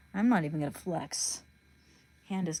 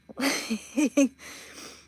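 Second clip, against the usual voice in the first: higher-pitched giggle; real laugh at their reactions; amused laugh; 5 semitones higher than usual. Real, not acted. Laughs at pissed-off guys.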